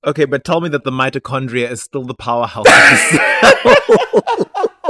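loud laughing plea; half-laughing demand; mock pleading through laughter; laughing quote. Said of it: "someone online being like".

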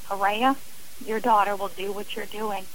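usual speaking voice

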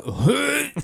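high, loud imitated retch; mock gag. Imitating the tricked kid on camera, mock gag. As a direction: fake a sudden high-pitched gag. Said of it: "she keeps, like, gagging".

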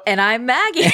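bright announcer-style intro; chirpy show host hello; podcast intro voice. Shown as a demonstration. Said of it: "about to, like, really just get into my usual".

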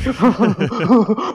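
high, overexcited put-on cackle; imitated maniacal laugh. Son giggling on the way to the car, maniacal laugh. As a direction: laugh like a child bursting with a secret. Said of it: "his maniacal laugh".

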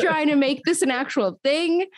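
high, incredulous finish to the protest; indignant protest. Retold or imitated. Still protesting to her friend that it was only a joke, incredulous. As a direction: push the disbelief up high.

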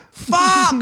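high-pitched panicked yelp; retold scream. Seeing his friend fall in the moat, panicked cry. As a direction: act out sudden alarm. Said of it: "I'm, like, screaming".

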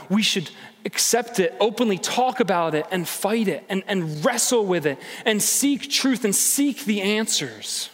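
ordinary talk

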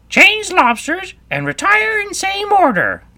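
very high, loud creature voice; Griffin calling out. Acted. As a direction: shout the dance steps as the Griffin. Griffin calls out dance steps.

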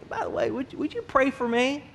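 timid request. Retold or imitated. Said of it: "they say".

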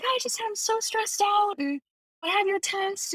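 high, thin mock-crying voice; retold bawling. Student confessing stress to Spanish teacher, mock tearful. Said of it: "just, like, dead crying".

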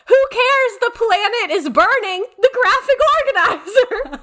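very high, squeaky mock outrage; mocking imitation. Student mocking the teacher's worksheet panic, very high and hammed-up. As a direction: overdo the despair for laughs. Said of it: "making fun of me".

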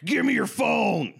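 put-on high, slow shouting old man; horrifying old man. Guest imitating an old man at young fans, high slow shout. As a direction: yell it like a crazed old man. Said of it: "horrifying old man being like".

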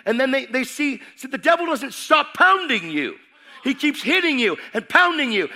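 high, loud, driving preacher voice; full-force preaching. Acted. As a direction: preach it hard to the congregation.

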